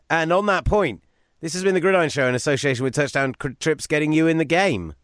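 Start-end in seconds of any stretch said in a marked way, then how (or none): 1.4-4.9 sponsor sign-off read. Acted.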